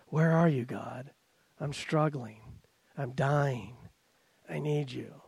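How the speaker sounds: soft, broken prayer of someone struggling; almost a whisper, pleading for help; hushed desperate plea. Retold or imitated. Preacher voicing a struggling believer begging God, hushed.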